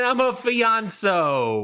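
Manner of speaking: high, slow, drawn-out word; playful. Pretend. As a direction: stretch it out, high and silly.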